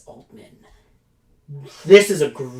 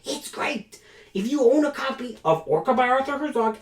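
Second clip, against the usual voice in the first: jumps much higher into a squeaky put-on voice; squeaky mock praise; 11 semitones higher than usual. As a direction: squeal it like a cartoon fanboy. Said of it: "I get really angry about that book".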